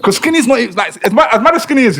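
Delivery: animated and emphatic; excited. Real, not acted. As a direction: push the point with rising energy. Excited point about Skinny.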